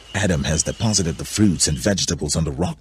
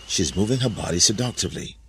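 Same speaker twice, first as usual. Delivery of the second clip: ordinary delivery, nothing marked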